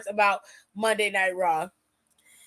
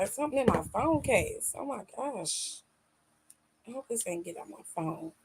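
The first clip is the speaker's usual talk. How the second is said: soft, distracted aside; muttered aside; 3 semitones lower, 10 dB quieter than usual. Real, not acted. An aside while checking the oily phone case. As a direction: talk softly to yourself about the phone.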